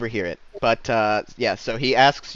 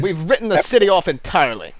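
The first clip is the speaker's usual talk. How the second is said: loud, raised knightly pronouncement; dismissive paladin; 7 semitones higher, 6 dB louder than usual. Acted. Paladin answering a peaceful visitor, loud and dismissive. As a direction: declare it with lofty finality. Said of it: "the paladin".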